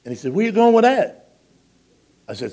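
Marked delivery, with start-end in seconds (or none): 0.3-1.4 high, loud indignant question; indignant protest. Retold or imitated. Transient man protesting as the pastor takes his tray, loud and high. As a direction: demand it back, affronted.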